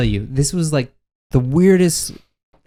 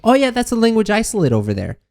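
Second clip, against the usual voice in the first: high-pitched imitation of delight; retold excited teacher; 10 semitones higher than usual. Imitating the teacher recognizing his town, high and excited. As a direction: voice an intrigued teacher, much higher.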